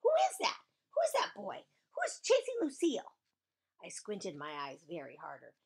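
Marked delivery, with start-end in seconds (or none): 0.0-3.2 very high, excited shouted questions; high-pitched yelling. Acted. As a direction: fire off high, urgent questions. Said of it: "she yelled".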